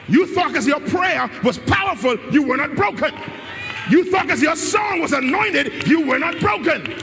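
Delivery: whooping sermon build; preaching climax. Acted. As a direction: drive the church toward shouting, line by line. Rising sermon climax with shouts.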